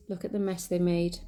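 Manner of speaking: usual speaking voice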